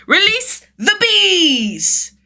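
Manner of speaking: very high, loud, slow battle cry; release shout. A narrator's reading. Boy unleashes the bees, long high shout. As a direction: drag out the command like a war cry. Child triggers the trap.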